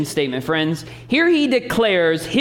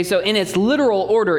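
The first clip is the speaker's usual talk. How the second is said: normal talking voice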